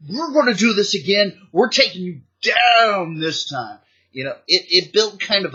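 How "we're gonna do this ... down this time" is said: put-on kids' challenge. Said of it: "every kid was just like".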